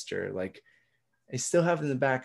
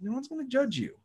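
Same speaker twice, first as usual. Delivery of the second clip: high reassuring; 11 semitones higher, faster than usual. Retold or imitated. Reassuring advice quote.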